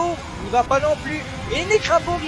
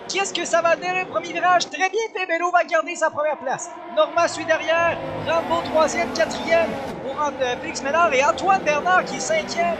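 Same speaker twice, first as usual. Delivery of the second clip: motorsport commentator; 5 semitones higher than usual. Real, not acted. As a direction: lively race play-by-play, pitched up. Live race play-by-play. (commentateur de course animé)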